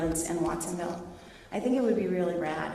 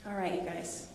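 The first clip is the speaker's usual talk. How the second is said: ordinary talk, nothing marked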